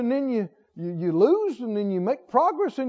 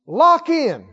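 loud, higher call to the crowd; rallying shout; 5 semitones higher, 8 dB louder, slower than usual. Real, not acted. Preacher urging everybody to lock in, loud rallying call. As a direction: rouse the whole room.

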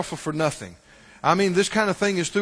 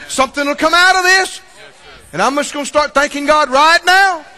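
raised, punchy pulpit shouting; full-force preaching; 6 semitones higher, 7 dB louder than usual. Real, not acted. Preacher urging believers not to be whiny, forceful shouting. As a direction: drive each phrase home to the congregation.

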